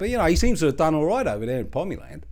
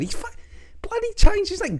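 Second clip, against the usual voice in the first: ordinary delivery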